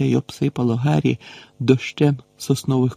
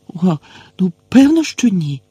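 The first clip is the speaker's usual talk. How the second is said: nervous squeak; 6 semitones higher, 3 dB louder than usual. A narrator's reading. Frightened girl squeaking an answer about the giant. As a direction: voice jumping much higher on a squeak. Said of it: "пискнула Герміона".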